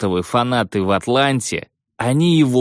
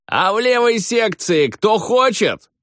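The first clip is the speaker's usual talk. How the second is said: calling out; 12 semitones higher than usual. Retold or imitated. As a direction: call across the arena. Calling out to crowd section.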